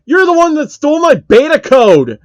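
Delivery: loud, high accusing scream; outraged screaming gamer. Acted. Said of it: "Phil screamed at him".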